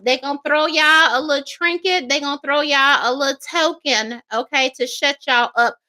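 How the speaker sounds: ordinary delivery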